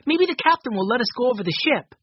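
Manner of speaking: put-on quick, high eager boy's voice. A narrator's reading. Benny hoping to board the ship, fast eager voice. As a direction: rush the hopeful words, fast.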